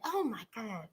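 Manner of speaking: a hushed, dropped "Oh my God"; stunned hush. Retold or imitated. Retelling her own stunned reaction to the show, hushed. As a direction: breathe it out low in disbelief. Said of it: "I said".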